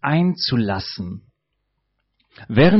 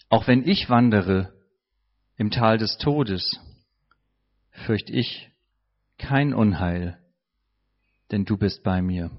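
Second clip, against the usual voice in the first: softer meditative repeat; 6 semitones lower than usual. Shown as a demonstration. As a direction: repeat the verse more inwardly, softer. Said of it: "Das würde ungefähr so aussehen".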